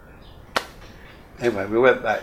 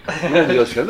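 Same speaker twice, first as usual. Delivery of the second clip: normal talking voice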